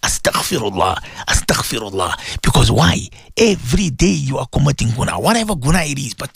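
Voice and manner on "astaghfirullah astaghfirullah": a low, devout "Astaghfirullah" three times; low devout recitation. Shown as a demonstration. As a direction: murmur it lower, like a prayer. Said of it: "make, say".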